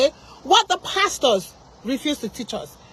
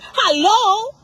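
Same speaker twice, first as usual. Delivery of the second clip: high, loud, incredulous hello; a raised, indignant call-out; incredulous outburst; 7 semitones higher, 5 dB louder than usual. Real, not acted. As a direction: challenge it in sharp disbelief. Indignant at the pastor's advice.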